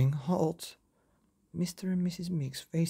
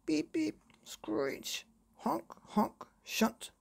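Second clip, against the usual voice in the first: traffic noises; 13 semitones higher, slower than usual. A narrator's reading. Narrator voices traffic noises.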